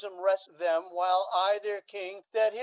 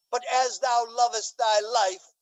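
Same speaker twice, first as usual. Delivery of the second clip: normal talking voice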